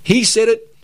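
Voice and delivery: high-pitched imitation of approving listeners; mock eager agreement. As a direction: play people chiming in, pitched well up. Acting out people agreeing with him.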